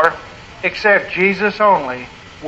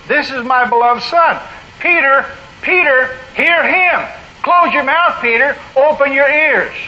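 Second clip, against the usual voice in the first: higher, commanding voice from heaven; raised, authoritative fatherly command; God the Father; 6 semitones higher than usual. Acted. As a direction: firmly order a disciple to listen. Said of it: "a voice speaks, God the Father".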